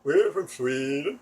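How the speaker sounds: a light, slowed Swedish lilt; slow, sing-song Swedish accent; mock Swedish accent. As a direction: imitate the Swedish man's accent loosely. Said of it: "and I noticed the accent".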